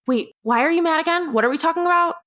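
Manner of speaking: high, fast, feigned-innocent confusion; mock amnesia. Imitating her boyfriend pretending amnesia, high and fast. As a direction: play the clueless boyfriend, voice way up. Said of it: "pretending that he had, like, amnesia".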